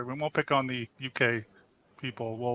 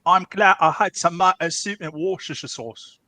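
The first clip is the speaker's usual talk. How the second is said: hammed-up Cockney, higher pitched; terrible Cockney accent; 4 semitones higher, 4 dB louder than usual. Shown as a demonstration. Podcast host showing off the English accent they enjoy, terrible Cockney. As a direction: do a bad, playful Cockney. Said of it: "which is a terrible Cockney accent".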